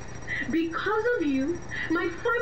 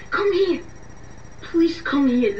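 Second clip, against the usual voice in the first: ordinary delivery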